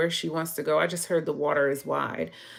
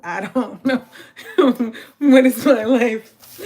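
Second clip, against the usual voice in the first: cracking up, loud and higher; laughing; 6 semitones higher, 11 dB louder than usual. Real, not acted. Streamer despairs at her best friend's yelling, laughing hard. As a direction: laugh helplessly through the words, ending loud.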